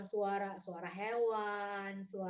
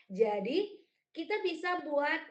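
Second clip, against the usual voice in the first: ordinary talk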